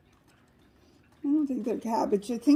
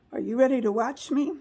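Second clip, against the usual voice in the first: ordinary talk, nothing marked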